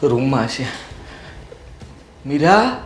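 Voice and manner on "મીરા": calling out. Real, not acted. Calling wife through house.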